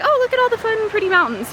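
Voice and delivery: high gushing sightseer voice; mock gushing over the view; gushing tourist. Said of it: "more than just, like".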